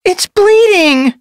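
very high, slow alarmed kit; alarmed exclamation. A narrator's reading. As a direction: cry out in alarm. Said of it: "Sorrel Paw exclaimed".

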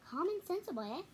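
a touch of mock teasing; wry remark. A narrator's reading. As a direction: needle him gently. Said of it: "she said, looking at Papa".

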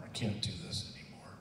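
breathy, near-silent plea trailing away; a desperate man giving up, whispered; broken whisper. Retold or imitated. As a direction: let the prayer collapse into a whisper. Desperate prayer in bed.

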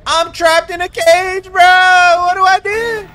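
loud high panicked yelling; panicking gamer. Real, not acted. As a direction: scream for help, trapped. Trapped in cage, panicking.

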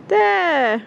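excited call, pointing something out. Real, not acted. Spotting dolphins, calling out.

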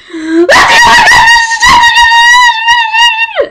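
long high-pitched loud squeal; frantic fangirl squeal down the phone; excited squeal. Retold or imitated. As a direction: squeal wildly on one held high note. Retelling excited phone call to friend.

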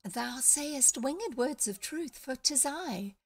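put-on high, slow, small girlish voice; the parrot posing as a fairy, high and slow; fairy queen voice. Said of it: "small, high voice".

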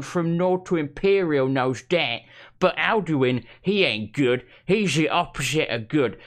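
broad Essex bloke reading aloud; lightly put-on Essex accent; Essex accent impression. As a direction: read it like a dim Essex lad. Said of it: "That was my impression".

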